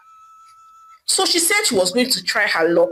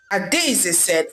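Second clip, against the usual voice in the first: ordinary delivery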